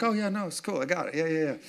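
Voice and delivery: mock-casual "yeah, yeah"; breezy brush-off. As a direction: brush off the reminder lightly. Husband brushing off wife's reminder.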